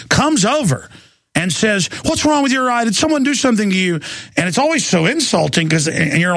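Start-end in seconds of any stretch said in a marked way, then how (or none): 2.1-4.0 high, fast, prying mock voice of a busybody; mocking nosy stranger. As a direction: ape a nosy stranger, pitched up and quicker. Dad mimics nosy liberal stranger.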